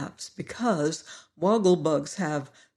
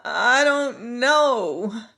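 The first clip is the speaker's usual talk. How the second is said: slow, higher, louder moaning in pain; 5 semitones higher, 5 dB louder, much slower than usual.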